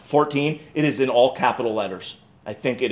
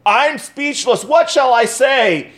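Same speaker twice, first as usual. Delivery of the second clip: higher and slow, overcome with wonder; awed joy; 9 semitones higher, 3 dB louder, slower than usual. Acted. Healed king marvelling to God, higher and slow. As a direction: say it like you can barely find words. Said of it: "Hezekiah is saying".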